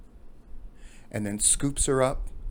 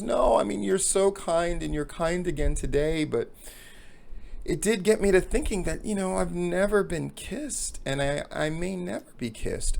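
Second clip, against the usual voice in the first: higher-pitched woman's voice, mildly plaintive; acted tearful woman; 6 semitones higher than usual. Crying woman confiding in the jogger, high plaintive voice. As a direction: play the sad woman, pitched up. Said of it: "she's crying and she's sobbing".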